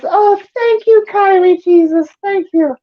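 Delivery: high, overcome mock gratitude; gushing rescued convert. Drowning believer thanking Jesus for rescue, hammed-up gratitude.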